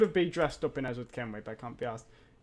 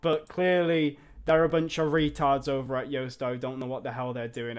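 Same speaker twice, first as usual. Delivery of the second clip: usual speaking voice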